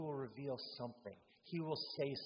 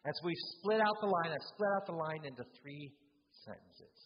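ordinary talk, nothing marked

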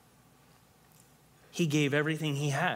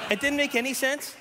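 surprised; 11 semitones higher than usual. Real, not acted. Surprised at friend's correction.

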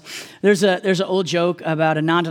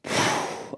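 long tired unvoiced sigh; exhausted sigh; weary sigh; 8 dB quieter than usual. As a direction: sigh like a worn-out teacher. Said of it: "Jesus just go like".

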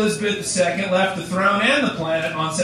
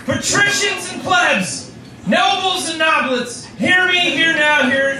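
town crier; 6 semitones higher than usual. Acted. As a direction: proclaim it to the whole square. Proclaiming like a crier.

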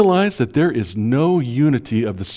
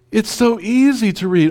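much higher, airy mock-casual tone; mock breezy; 10 semitones higher, 3 dB louder than usual. Pastor mocks the casual Bible reader, breezy and much higher. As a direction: toss it off like reading is nothing.

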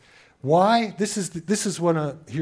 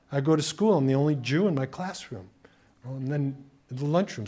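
ordinary delivery

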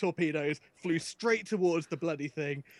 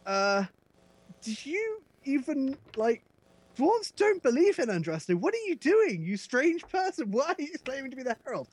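incredulous NPC; 8 semitones higher, 4 dB louder than usual. Retold or imitated. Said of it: "I wasn't expecting".

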